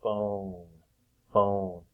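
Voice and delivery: phone with a long oh vowel. Shown as a demonstration. Teacher sounding out phone for the class, long oh. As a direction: stretch the oh in phone. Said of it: "I hear an O sound".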